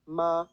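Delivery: ma held high and level; first tone ma. Shown as a demonstration. Teacher demonstrating Mandarin tones, high level ma. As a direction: sing ma out high and steady. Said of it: "you will hear".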